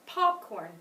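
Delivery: Popcorn with POP stressed; stress on first syllable. Shown as a demonstration. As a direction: lean on the Pop in Popcorn, corn lighter. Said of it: "that was the first syllable too".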